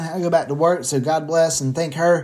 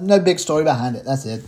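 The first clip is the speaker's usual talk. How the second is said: normal talking voice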